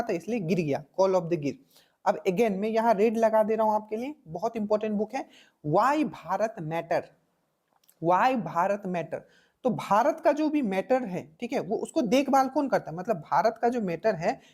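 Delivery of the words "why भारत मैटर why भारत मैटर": title drawn out slowly, twice; slow title read. Shown as a demonstration. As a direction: read it word by word for notes. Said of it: "reading book title slowly". (धीमे-धीमे शीर्षक बोलना)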